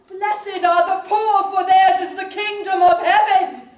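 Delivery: mock British preacher on a hill; British preaching voice. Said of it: "If I talk British, they listen".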